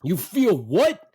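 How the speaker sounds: loud, high mock bark; barking dad. Dad to mother on the bench, barking. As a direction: imitate a father barking in disbelief. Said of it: "my dad barks".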